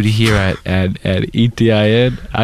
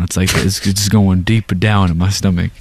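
normal talking voice

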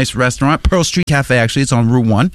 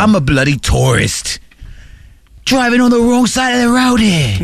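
mock British tourist climbing higher; hammed-up English accent, ending high; 8 semitones higher than usual. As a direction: start normal pitch, rise into silly British. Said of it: "old guy with a British accent".

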